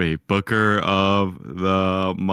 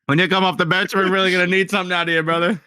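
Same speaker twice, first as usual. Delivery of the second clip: higher, fast hyped coach; basketball coach voice; 7 semitones higher than usual. Pretend. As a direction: push the bench player, brother. Coach pep talk bit.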